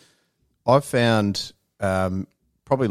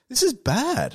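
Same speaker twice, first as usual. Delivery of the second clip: much higher-pitched retold dismay; dismayed reaction; slower than usual. Customer reacting to bad repair options, dismayed. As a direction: pitch it right up in mock alarm.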